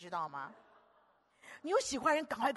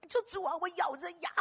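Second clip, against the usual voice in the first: normal talking voice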